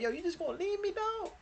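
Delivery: high, pleading brother voice, mildly acted. Brother begging his sibling not to leave him, high pleading. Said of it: "but he still ain't like".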